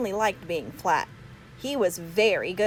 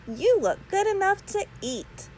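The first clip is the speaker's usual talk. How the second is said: mother's voice pitched high and sweet; high motherly voice; 12 semitones higher than usual. Acted. Mother admiring son's pancake costume, high cooing voice. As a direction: coo it like a doting mom.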